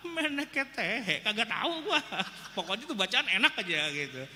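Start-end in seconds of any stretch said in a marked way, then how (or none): 0.1-2.0 mock clueless shrug. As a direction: play dumb with a high laughing voice. Mocking clueless worshipper reply.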